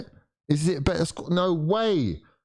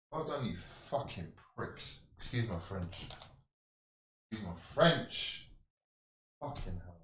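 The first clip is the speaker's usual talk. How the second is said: much softer bitter mutter; muttered insult; 4 semitones lower, 14 dB quieter than usual. Real, not acted. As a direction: grumble it under your breath. Fan mutters insults, apologises.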